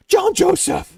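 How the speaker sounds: high, sharp mother calling the full name; scolding mom. Retold or imitated. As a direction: call out his full name like an angry mom. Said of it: "used his middle name".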